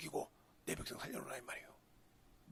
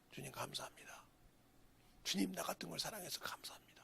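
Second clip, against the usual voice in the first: hushed prayer; 3 semitones lower, 12 dB quieter than usual. Acted. Voicing a humble prayer.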